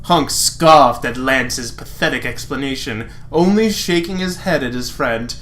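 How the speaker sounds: ordinary delivery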